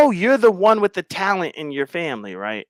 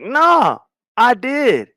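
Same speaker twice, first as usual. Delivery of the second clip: voicing her reply, higher; 7 semitones higher, 4 dB louder, much slower than usual. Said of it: "she's like".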